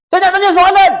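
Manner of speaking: high, loud demanding bark; mock angry customer. Angry customer at the inquiry counter, mock demand.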